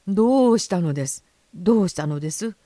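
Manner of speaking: lightly voiced official asking what's wrong; urgent, puzzled inquiry; concerned railwaymen. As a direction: come running and ask. Railwaymen rush over, asking what happened.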